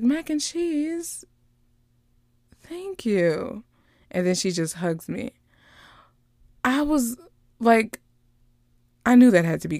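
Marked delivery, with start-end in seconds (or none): 0.0-3.6 high, drawn-out affectionate nickname; touched, tender thanks. Retold or imitated. Aunt thanking her niece by nickname, tender and touched. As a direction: coo it gratefully, imitating the moment.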